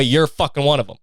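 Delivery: ordinary delivery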